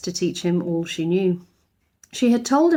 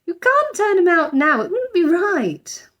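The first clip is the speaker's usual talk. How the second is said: higher, pleading motherly voice, slowing at the end; mother urging her husband, higher voice slowing down; arguing mother; 10 semitones higher, 4 dB louder than usual. A narrator's reading. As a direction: press the point gently but firmly. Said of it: "argued Missus Brown".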